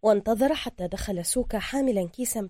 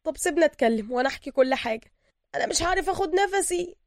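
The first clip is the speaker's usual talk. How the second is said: high, strained, desperate denial; choked pleading; 8 semitones higher, 6 dB louder than usual. A narrator's reading. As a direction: plead frantically as if short of air. Choked man pleads he can't breathe.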